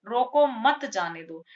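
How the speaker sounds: रोको set off firmly, then मत जाने दो together; pause after रोको. Shown as a demonstration. Teacher showing students the stop-him reading, pause after रोको. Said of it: "यानी कि रोक लो उसे".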